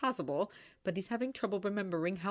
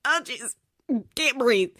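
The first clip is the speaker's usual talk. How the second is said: flustered; 6 semitones higher, 8 dB louder than usual. A narrator's reading. Flustered inner panic.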